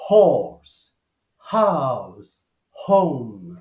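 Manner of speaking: "horse, house, home" with English aspirated h. Shown as a demonstration. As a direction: show the English h sound. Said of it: "como em inglês".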